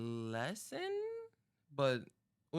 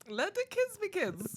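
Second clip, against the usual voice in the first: mock childlike voice. Mocking kiddie rap stance.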